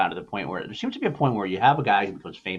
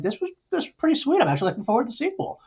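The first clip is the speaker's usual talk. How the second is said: 1 mock delighted quote; faster than usual. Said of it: "I was kinda like".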